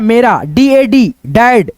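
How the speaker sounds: usual speaking voice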